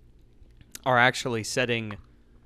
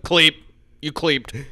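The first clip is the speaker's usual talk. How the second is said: mock call-out; 3 semitones higher, 6 dB louder, much slower than usual. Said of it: "whenever anybody else Biden's".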